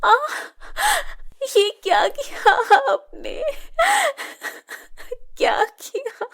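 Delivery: high, breaking scream of pain; cry of pain. Acted. Shilpa crying out in pain at her stepmother, high and broken. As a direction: cry out as the tongs burn. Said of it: "Shilpa चिल्लाने लगती है".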